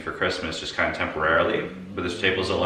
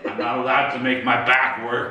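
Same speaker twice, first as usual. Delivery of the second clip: half laughing; amused; 6 semitones higher than usual. Real, not acted. Joking about back rule.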